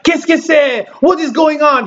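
imitating an exasperated French guy, high-pitched; high, frustrated outburst, retold. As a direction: raise the pitch in mock frustration. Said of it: "gets frustrated, stands up".

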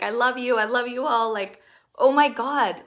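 gushing quoted praise. Retold or imitated. Host voicing adoring group members, excited gush. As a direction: read their love out like a thrilled fan. Quoting supportive chat messages.

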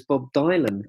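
"Dylan" said as DIE-lan; long i in the first syllable of "Dylan"; mistaken pronunciation. Retold or imitated. Recalling someone's first misreading of the name, "Dylan" said DIE-lan. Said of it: "he assumed it was".

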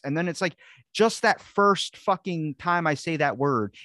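ordinary talk, nothing marked

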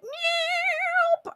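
a high, far-off mock meow; cat meow. Energy vampires spotting her light, as a high mock meow.

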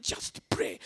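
voiceless, strained rasp of effort; struggling to speak. Retold or imitated. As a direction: squeeze the words out through a covered mouth. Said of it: "I'm struggling to speak".

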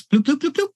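high popping bloop sounds. Acted. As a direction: pop out the copies, bloop bloop. GM sound effect for duplicates.

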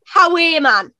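"How are you, man?" as Geordie "ha-WAY man"; Geordie demo. Shown as a demonstration. Teaching a Geordie phrase, "How are you, man?" said as "howay man". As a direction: stress the long middle "are" like "way", then drop on "man".

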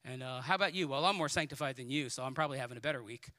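fast, low casual back-and-forth; lightly acted one-upping chatter, brisk and low; mock comparing chat. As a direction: rattle it off like petty small talk. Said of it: "get together comparatively and say".

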